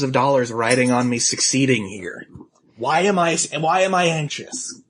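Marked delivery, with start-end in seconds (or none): none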